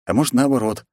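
usual speaking voice

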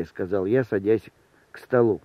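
usual speaking voice

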